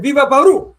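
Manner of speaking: imitating Spanish accent. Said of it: "The way you said".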